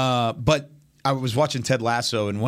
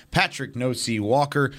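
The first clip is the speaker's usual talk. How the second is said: ordinary delivery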